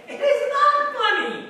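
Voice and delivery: loud, high, laugh in the voice; high-pitched amused objection; laughing protest. Real, not acted. Laughing over toddler swearing.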